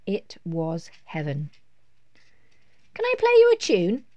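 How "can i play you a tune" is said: put-on very high, loud little boy's request; eager child. A narrator's reading. Lester begs Mum to hear his tune, high and eager. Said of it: "asked Lester".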